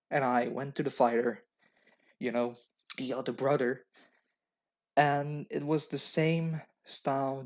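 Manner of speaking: usual speaking voice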